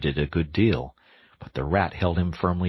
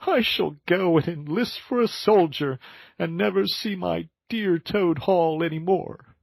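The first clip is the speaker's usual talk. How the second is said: very high, plaintive animal voice; hammed-up self-pity; Toad, tearful; 11 semitones higher than usual. Toad laments losing Toad Hall, high and tearful.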